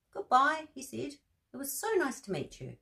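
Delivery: high, friendly owl voice; Owl's voice. Acted. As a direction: sing out goodbye as the owl. Owl voiced saying goodbye.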